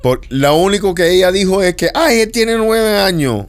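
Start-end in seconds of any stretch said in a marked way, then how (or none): 1.9-3.4 high, slow mock mother's plea; drawn-out, hammed-up maternal protest. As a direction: imitate a mother making excuses, pitched up. Imitates the mother's excuse again.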